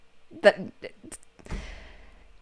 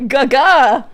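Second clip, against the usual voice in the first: loud nickname; 5 semitones higher, 9 dB louder than usual. Retold or imitated. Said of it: "Kittor calls Stitch Gaga".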